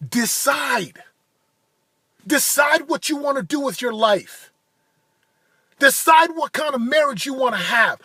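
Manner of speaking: motivational preaching. Acted. Urging viewers to decide their lives, preaching hard. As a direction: preach it like a coach firing up the crowd.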